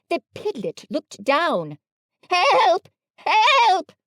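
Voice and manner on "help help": high, loud cries of help; panicked cry. A narrator's reading. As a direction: squeal for help in terror. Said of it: "cried Piglet".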